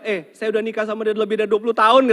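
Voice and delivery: high, loud and fast; fast comic husband. Acted. Husband telling the jinn about twenty years of marriage, high, loud, fast. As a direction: rattle it off in comic despair.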